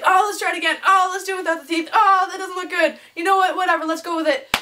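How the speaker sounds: high, fussy mock complaints; imitated fussing. Teacher fussing over his photo retakes, imitated exasperation. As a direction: raise the pitch and fuss through each retake.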